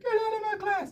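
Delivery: high, loud screech of an irate teacher; mock teacher yelling. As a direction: yell it way up high, imitating a furious teacher. Imitates teacher throwing her student out.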